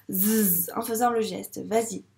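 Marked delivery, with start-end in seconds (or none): 0.1-0.7 "Z" as a sustained buzz; son Z isolé. Shown as a demonstration. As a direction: hold "Z" as a voiced zzz, not the letter name. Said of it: "dire le son". (« Z » prononcé zzz, tenu)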